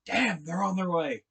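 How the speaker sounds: alarmed exclamation. Retold or imitated. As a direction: exclaim it as Joe realizing trouble is coming. Acts Joe's alarm at radio news.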